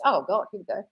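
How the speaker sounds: ordinary talk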